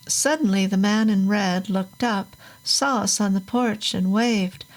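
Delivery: ordinary delivery, nothing marked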